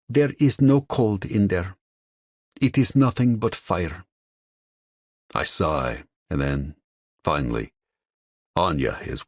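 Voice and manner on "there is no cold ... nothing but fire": put-on Russian accent. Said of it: "She would say".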